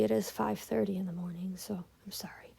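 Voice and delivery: hushed, breathy whisper at dawn; sleepy whisper. Real, not acted. Apologizing to students at five thirty, whispered. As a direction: drop to a whisper, barely voiced.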